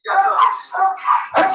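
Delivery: high breathy giggle; real high giggling laugh. Real, not acted. Laughing at the kids.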